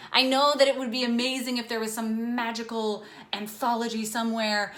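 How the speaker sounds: ordinary talk, nothing marked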